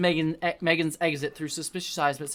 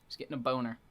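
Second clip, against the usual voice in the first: He's getting a boner said low and softer, deadpan; muttered aside; 3 semitones lower, 9 dB quieter, faster than usual. Real, not acted. Reader notes the hero's arousal, quiet deadpan. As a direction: drop it quietly as a dry aside.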